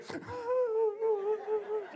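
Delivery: high, soft comic wail; mock crying. Kid in the back seat of the car, mock crying. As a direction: imitate a kid's long whimpering cry. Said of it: "just crying".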